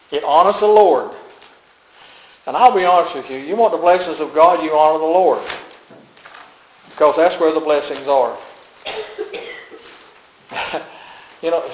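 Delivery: normal talking voice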